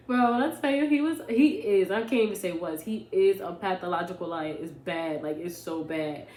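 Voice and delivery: ordinary talk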